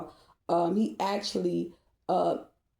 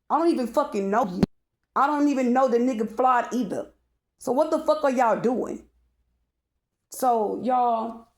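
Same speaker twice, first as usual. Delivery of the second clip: loud, higher angry yelling; pissed off; 4 semitones higher, 4 dB louder than usual. Real, not acted. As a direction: yell it out, fed up and furious. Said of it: "you'll see why I'm getting pissed off".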